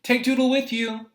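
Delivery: high, loud call across the house; Mama calling out. Retold or imitated. Mother calling her son from across the house, high and loud. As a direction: shout it out like a mother calling from far off. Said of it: "Mama would call from wherever she was".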